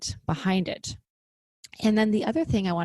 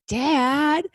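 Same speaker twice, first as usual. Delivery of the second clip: son's mock-indignant "Dad!", higher and louder; drawn-out, high, loud mock telling-off; imitated scolding child; 8 semitones higher, 7 dB louder than usual. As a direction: call out like a kid catching a parent swearing. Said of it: "right away he's like".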